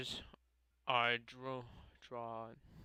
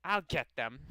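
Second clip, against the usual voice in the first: put-on higher, louder anime-girl character voice; Osana; 5 semitones higher, 5 dB louder than usual. Eager club girl telling friends about her cupcakes, raised character voice. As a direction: voice a game girl, pitched up and punchy. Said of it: "like, Osana's voice from Yandere Simulator".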